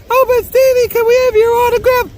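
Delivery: very high, loud, quick fan plea; begging fan girl. Pretend. Imagined fans begging him for an autograph, very high and eager. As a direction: beg like an excited fan. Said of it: "they'd be like".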